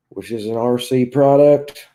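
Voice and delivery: usual speaking voice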